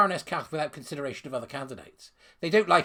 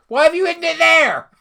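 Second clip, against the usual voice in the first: much higher, much louder put-on voice; mock shrill interrogator; 13 semitones higher, 14 dB louder than usual. Mocking the report writers with a loud high squeal.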